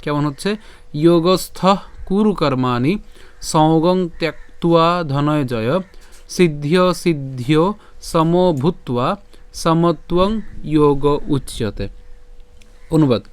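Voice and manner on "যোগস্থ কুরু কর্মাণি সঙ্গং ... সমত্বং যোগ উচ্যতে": slow, measured verse recitation; pure Sanskrit pronunciation. Shown as a demonstration. Teacher pronounces the verse from the beginning, slow careful Sanskrit. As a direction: recite the shloka phrase by phrase, drawing out the words. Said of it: "প্রথম থেকে আবার উচ্চারণ করি".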